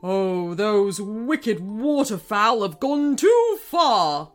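bawling king. Acted. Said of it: "bawled King Krusty".

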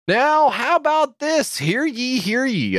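booming town crier proclamation; high, slow herald's call. Acted. Proclamation opener "hear ye.

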